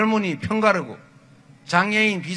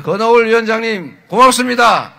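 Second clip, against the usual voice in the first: shouted thanks; 3 semitones higher, 8 dB louder than usual. Real, not acted. Thanking chairman before crowd.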